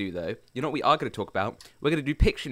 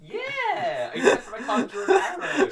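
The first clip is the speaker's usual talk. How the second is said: laughing; 5 dB louder, slower than usual. Real, not acted. Laughing at own bad drawing.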